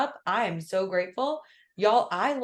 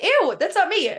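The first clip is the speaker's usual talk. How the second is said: disgusted outburst; 10 semitones higher, 10 dB louder than usual. Retold or imitated. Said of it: "I was like".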